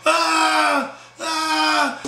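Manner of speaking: high, drawn-out "ah", twice; mock scream. Storyteller mimics Brian's girl at the fight, mock scream. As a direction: imitate a screaming woman with long high wails. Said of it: "Sophia screaming".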